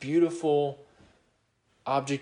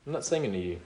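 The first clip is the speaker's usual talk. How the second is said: quieter and lower, let it settle; a hushed, lower restatement; soft repeat; 6 semitones lower, 5 dB quieter, much faster than usual. Real, not acted. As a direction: drop the voice for the echo. Soft repeat of the previous line.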